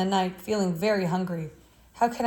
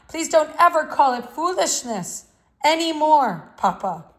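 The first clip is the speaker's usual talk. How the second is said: louder, higher and insistent, repeating the point; a son finally standing up to his father; raised, urgent, pleading defiance; boy's heated plea; 5 semitones higher, 5 dB louder than usual. A narrator's reading. Boy defends his drawing to father.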